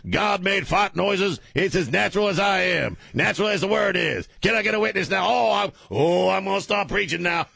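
high-pitched, chanting sermon rant; hammed-up preacher tirade; radio preacher. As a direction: scold a sinner, building to revival fervor. Preacher impression scolding the co-host.

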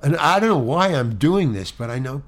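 ordinary delivery

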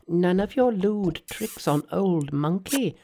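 high Monkey voice with a hint of a laugh; gleeful aside to himself; Monkey chuckling. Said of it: "laughed Monkey to himself".

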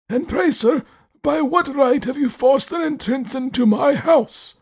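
put-on high, slow, stern old man voice. A narrator's reading. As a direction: demand an answer, cold and proud. Host demands, stern old man.

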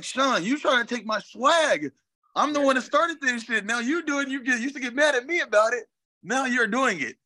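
high-pitched mock protest; indignant teasing complaint. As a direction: call out a copycat friend, voice lifted high. Acts out complaining to his old teammate.

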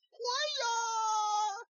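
high, drawn-out bro yell; showing off his bro voice to his wife, shrill and drawn out; annoying bro. Shown as a demonstration. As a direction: holler it like a party bro. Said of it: "annoying bro voice".

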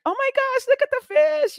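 very high, fast, delighted gush over a fish; excited squeal. Retold or imitated. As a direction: squeal like a kid spotting something cute. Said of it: "And I'm like".

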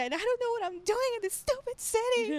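high, laughing fake sob; mock crying. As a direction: wail it out through a laugh, half-joking. Retold crying call home.

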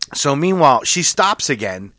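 ordinary delivery

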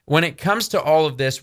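ordinary delivery

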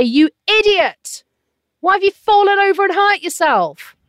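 high, loud berating; acted-out cruel telling-off; harsh scolding. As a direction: berate a child who has tripped. Voicing a parent berating fallen child.

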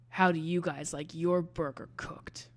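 hushed, deadpan one-liner; menacing whisper. Acted. Brad turning to his speechless fight guys, menacing whisper. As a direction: whisper it like an action hero's payoff. Brad's menacing line to silent room.

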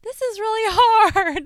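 high, slow, laughing complaint; loud high laugh at how hard it is. Retold or imitated. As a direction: laugh through the struggle. Struggling at tennis, laughing.

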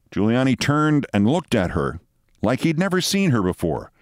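ordinary talk, nothing marked

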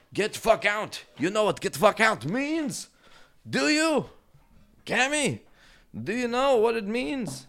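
very high, loud scolding squeal; scolding the cat. Real, not acted. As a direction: yell at a pet in a shrieking falsetto. Yelling at cat to leave.

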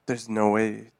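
usual speaking voice

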